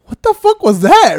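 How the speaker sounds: high, loud and slow disbelief; imitating a stunned outburst. Imitating Pancho seeing the replay, loud slow disbelief. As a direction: drag it out like the replay just blew your mind. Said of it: "he goes".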